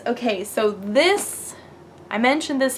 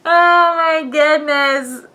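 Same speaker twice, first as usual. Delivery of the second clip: long exasperated oh my goodness, laughing at herself; slow loud exclamation; drawn-out exclamation; 4 semitones higher, 7 dB louder, much slower than usual. Real, not acted. Exasperated at own rambling.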